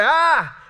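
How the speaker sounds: high, loud mock toddler cry. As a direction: belt out a cranky baby's "ah". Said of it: "he cries out a little louder".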